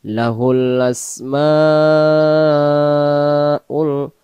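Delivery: slow melodic tajweed chant; Quran recitation. Shown as a demonstration. Teacher chanting the verse for students, slow and melodic. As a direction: draw out each vowel in recitation.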